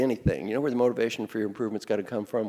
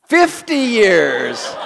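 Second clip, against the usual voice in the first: drawn out very slowly, higher and louder, in mock grief; mock lament; 7 semitones higher, 10 dB louder, much slower than usual. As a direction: stretch every word like a mock funeral. Mock-mourning an audience answer.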